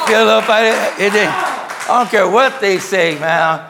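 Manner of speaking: loud, high acted protest; defiant shouting. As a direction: shout down any objection. Acting out defiant raised outburst.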